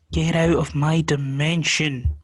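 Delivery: slow, loud, stern order; booming resident voice. Pretend. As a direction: boom it out slowly.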